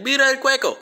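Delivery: high, excited call in Spanish; imitated pointing cry. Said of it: "she was pointing and saying".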